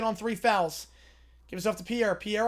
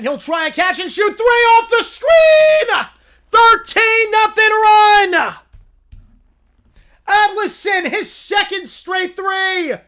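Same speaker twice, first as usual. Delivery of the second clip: screaming excitement; 9 semitones higher, 12 dB louder, much slower than usual. Real, not acted. Announcer screaming at back-to-back threes, voice cracking high. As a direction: voice cracks high on a huge shout. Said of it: "little voice crack there".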